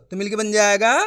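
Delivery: ordinary delivery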